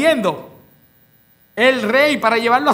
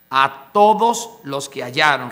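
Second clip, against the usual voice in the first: lower repeat; 6 semitones lower than usual. Shown as a demonstration.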